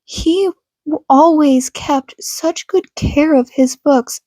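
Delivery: plain reading voice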